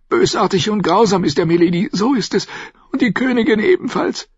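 put-on high-pitched, urgent girl's voice; anguished young girl. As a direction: pour out the accusation like a frightened girl. Girl blurts out the truth.

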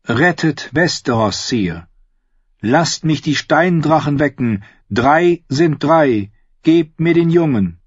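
put-on urging a stern king to give up the boy; Melisandre pleading.

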